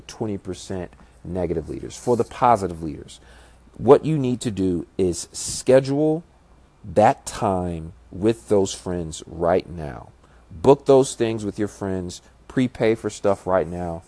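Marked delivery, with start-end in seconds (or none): none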